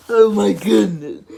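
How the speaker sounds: be stunned by the answer; amazed. Acted. Elephant amazed.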